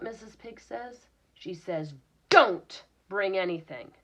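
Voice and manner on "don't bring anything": "Don't" much louder, snappy and bossy; bossy attitude. Acted. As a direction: boss them with "Don't". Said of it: "saying it with a little bit of an attitude".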